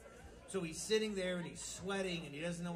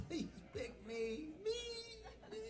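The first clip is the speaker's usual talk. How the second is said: pleading pupil; 9 semitones higher, 10 dB quieter than usual. Acted. Little Johnny in the corner, begging the teacher softly. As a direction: stretch out each plea like a kid desperate to be chosen.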